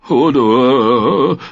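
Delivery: a lightly voiced giant's whimper. A narrator's reading. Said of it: "wimmerte er leise".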